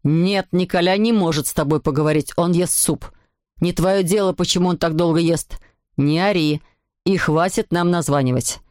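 low-pitched, firm telling-off; angry father. A narrator's reading. Papa warns Alceste off calling, low angry voice. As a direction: lay down the law as cross papa. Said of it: "Папа стал красным и закричал".

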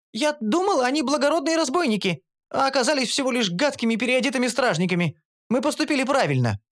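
high, eager gnome voice; a young gnome set straight; indignant gnome. A narrator's reading. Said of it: "откликнулась гномка". (гномка, высоким возмущённым голосом)

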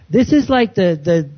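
ordinary talk

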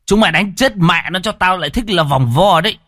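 loud, high furious command; angry order. Acted. Chief ordering guards to beat him, furious. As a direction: order the beating in a rage.

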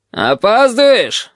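high, drawn-out scolding yell; querulous shout at a latecomer; cantankerous yell. A narrator's reading. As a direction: bawl it out crossly, slow and high. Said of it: "сварливо заорал стервятник".

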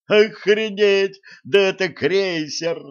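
high, loud cry of delight; ecstatic. A narrator's reading. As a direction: bounce with sheer joy, slowly shouting it out. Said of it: "запрыгал от восторга Джим". (восторженный возглас)

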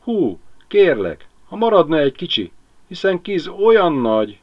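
a small bird begging for a share; pleading crow. A narrator's reading. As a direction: crow pleading with the owl for a bite. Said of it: "kopogott a varjú".

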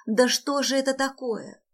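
high despairing outcry; crying out. A narrator's reading. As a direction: cry it out in frustration. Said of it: "выкрикнула она".

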